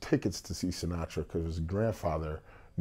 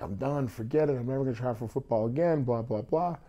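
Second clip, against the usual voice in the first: retold fed-up vow, pitched up; exasperated quitting; 6 semitones higher than usual. Retelling how he swore off football after being cut, exasperated. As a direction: toss it off higher, imitating your frustrated younger self.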